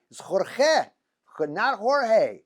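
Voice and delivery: Spanish Jorge set against the wrong announcer Jorge, both stressed; first Jorge throaty KHOR-kheh, then the anglicised one rejected; correcting contrast. Shown as a demonstration. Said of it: "the announcers say Jorge".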